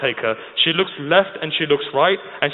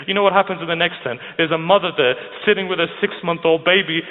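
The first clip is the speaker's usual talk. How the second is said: normal talking voice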